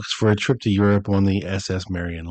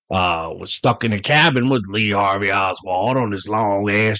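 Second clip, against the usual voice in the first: lightly hammed-up character voice; a hint of comic impression; Billy Joe Lord. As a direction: put on a mock voice of the letter writer. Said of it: "reading this in the Billy Joe Lord voice".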